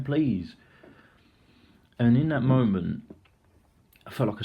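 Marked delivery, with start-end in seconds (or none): 0.0-0.6 pleading. Retold or imitated. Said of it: "he keeps on saying".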